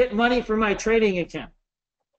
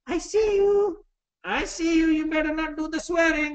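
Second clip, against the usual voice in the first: mom voice, teasing; 6 semitones higher than usual. Retold or imitated. Mom scolding on webinar.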